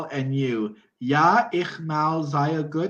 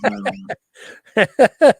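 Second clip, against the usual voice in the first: laughing out loud through the words; 6 semitones higher, 9 dB louder, much slower than usual. Real, not acted. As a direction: give up with a laugh.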